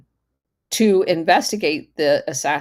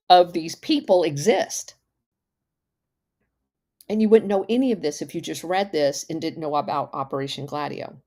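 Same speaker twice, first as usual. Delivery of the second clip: ordinary talk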